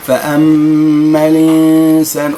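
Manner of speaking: slow, melodic tajwid chanting; Quran recitation. Shown as a demonstration. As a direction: chant the verse slowly. Recites the verse. (ترتيل)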